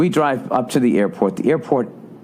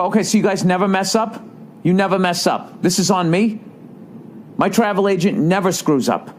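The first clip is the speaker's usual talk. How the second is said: high-pitched sarcastic comeback; indignant challenge; 9 semitones higher than usual. Retold or imitated. Traveler to agent who claims no fault, indignant. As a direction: act out an argumentative customer throwing blame back. Retold quarrel with counter agent.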